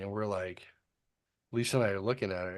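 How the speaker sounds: ordinary delivery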